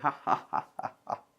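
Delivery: quiet chuckle at his own drawing; soft laugh. Real, not acted. Teacher laughing softly at his stick-figure drawing.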